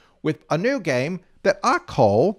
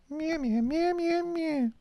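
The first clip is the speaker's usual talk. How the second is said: very high, soft little meows; kitten mews; 6 dB quieter than usual. Pretend. Host mews like a newborn kitten, very high and soft. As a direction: mew like a tiny kitten.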